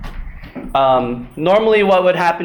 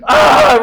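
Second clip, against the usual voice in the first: loud, higher mock yelp of pain; pained cry; 7 semitones higher, 8 dB louder than usual. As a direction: yelp as if stubbing a toe. Brother's hurt foot.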